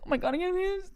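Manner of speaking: very high squealed fretting; mock panic. Shaking from adrenaline on the dunes, high mock panic. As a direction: squeal it as if overwhelmed.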